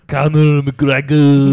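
slow, loud, drawn-out ring call; hammed-up ring announcer yell. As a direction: boom the fighter's name across the arena. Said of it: "some sort of a announcer".

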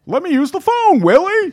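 high, loud alien squawk; Alf voice. Shown as a demonstration. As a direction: whine it like a grumpy alien. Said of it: "Everyone can do Alf".

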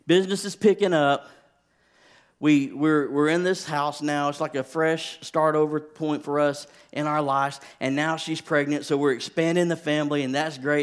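elated, calling out the good news; excited. Retold or imitated. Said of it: "and I was like".

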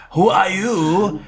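high, drawn-out mock-French question; slow, hammed-up French accent; Christopher Lambert impression. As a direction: ask it in an exaggerated French accent. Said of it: "And he goes like".

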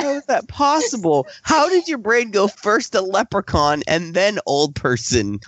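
baffled. Real, not acted. Baffled by Erica's story.

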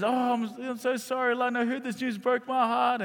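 high-pitched mock weepy voice; imitated tearful apology. Friend tells sister she heard the news, imitated tearful high voice. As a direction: plead as if crying, pitched high. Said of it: "Tracy starts crying".

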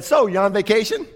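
high, bright chatty neighbor voice; chatty stranger. Retold or imitated. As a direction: pipe up in a nosy stranger's voice. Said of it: "She piped up and said".

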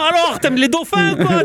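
put-on high-pitched South Park character accent; animated cartoon accent, high; Gérald's dubbed accent. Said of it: "cet accent, il est, il est vachement resté".

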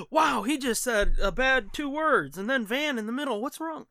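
gasp, then fake prim horror; mock shocked gasp. Mock outrage at the name.

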